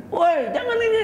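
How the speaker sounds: very high, sudden mock shout; harsh yell. Parent hollering at a child, a mock shout. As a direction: holler it across the yard. Harsh yelling contrasted with gentleness.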